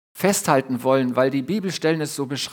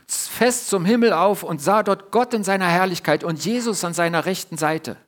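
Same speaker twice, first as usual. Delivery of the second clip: ordinary delivery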